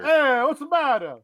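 loud mock Italian gangster accent; Mussolini impression. Offering the listeners his Mussolini, hammed-up Italian accent. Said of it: "my Mussolini?".

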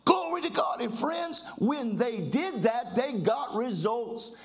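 rising, shouting preacher cadence; fiery preaching. Acted. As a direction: preach it with fire to the church. Preacher in full flight.

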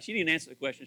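ordinary talk

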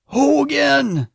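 wrestling announcer's high, loud shout; Mean Gene Okerlund. Acted. Announcer calling out a wrestler in a promo, big high shout. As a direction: holler it like a wrestling promo interviewer. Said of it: "Mean Gene, he was the announcer".

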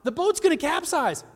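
high, loud panicked pleading; frightened fishermen shouting over the storm; panicked disciples. Retold or imitated. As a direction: cry out to Jesus in mock terror. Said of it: "they're like".

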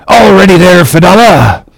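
high, ringing captain's hail; crying out. A narrator's reading. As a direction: call it out loud across the deck. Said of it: "Ahab cried out".

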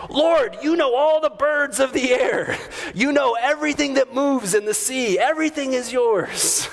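high, ringing mock holler to God; imitated screamed praise. Big hiker startling his buddy with praise, imitated high-pitched screaming. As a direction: proclaim it skyward in a high, sustained call. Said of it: "red-haired Scottish man started screaming".

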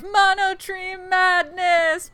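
high-pitched, hammed-up announcer hype; hyped event announcement. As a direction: announce it like a big show title. Announcing the episode's theme.

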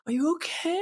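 very high breathy imitation; breathy Nicolas Cage voice. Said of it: "He's talking in this really breathy voice".

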